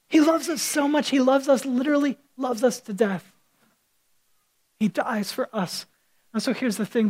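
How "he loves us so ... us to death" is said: ordinary talk, nothing marked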